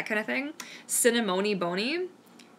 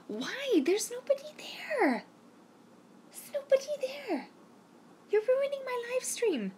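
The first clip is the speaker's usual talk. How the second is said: very high, soft falsetto plea; plaintive pet scolding; 5 dB quieter than usual. Real, not acted. Streamer to the dog barking at nobody, high plaintive scolding.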